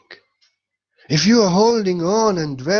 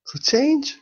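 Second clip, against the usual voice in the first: high exclaim; 11 semitones higher than usual. Real, not acted. Exclamation after pink story.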